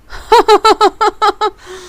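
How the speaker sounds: long, high, loud laughter; relieved laugh. Real, not acted. Player just scared by a survivor, relieved laugh. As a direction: burst out laughing after a fright.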